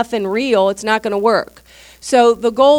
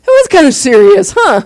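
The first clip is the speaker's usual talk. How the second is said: friend realizing; 7 dB louder than usual. Retold or imitated. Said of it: "they were like".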